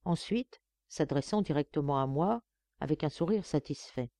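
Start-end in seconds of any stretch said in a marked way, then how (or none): none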